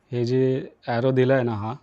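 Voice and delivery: ordinary talk, nothing marked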